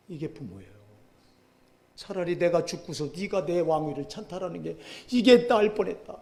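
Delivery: normal talking voice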